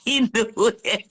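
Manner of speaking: fond remark breaking into laughter; laughing. Real, not acted. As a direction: say it slowly, then dissolve into laughs. Breaks into laughter while remembering.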